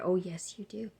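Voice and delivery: whispered, grave warning; ominous whisper. Retold or imitated. Staff warning the patient she needs cardiac care, whispered. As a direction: breathe it out like a dire secret.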